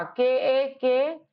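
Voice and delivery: very slow letters; slow spelling. Shown as a demonstration. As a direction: spell it out slowly. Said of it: "कक्का". (धीरे-धीरे spelling)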